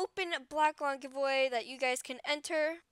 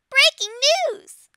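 high, loud squeaky announcer shout; Peck voice; 12 semitones higher, 12 dB louder than usual. Acted. Fan reading news to viewers in a high, loud Peck voice. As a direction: belt it out as a cartoon news crier. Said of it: "I'll use my Peck voice".

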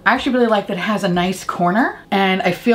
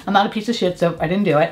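ordinary talk

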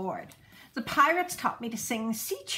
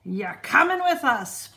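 pirate voice; 3 semitones higher, 7 dB louder than usual. A narrator's reading. Braidbeard tells boy.

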